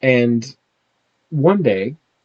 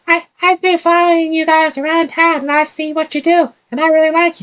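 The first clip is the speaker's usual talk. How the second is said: put-on very high kid voice; little boy voice; 3 dB louder than usual. Boy Carp telling the party he admires them, very high. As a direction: squeak it up like an eager little boy. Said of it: "the longest I'm gonna use that voice".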